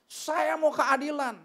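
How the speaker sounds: loud, high, drawn-out protest; angry demand. Retold or imitated. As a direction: imitate an indignant man demanding justice. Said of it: "marah-marah dia".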